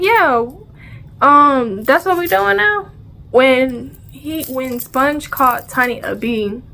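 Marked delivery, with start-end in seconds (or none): 0.0-2.9 pitched way up in sarcastic disbelief; what, that's what we're doing now?; mock disbelief. Retelling how she reacted when a friend got called a name, in high sarcastic disbelief.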